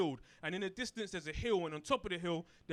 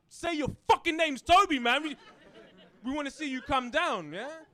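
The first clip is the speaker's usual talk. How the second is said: much higher, louder jeering crowd yell; taunting shout; 8 semitones higher, 9 dB louder than usual. Retold or imitated. Crowd of slaves jeering at Kunta, loud taunting yell. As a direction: bellow it like a mob egging him on.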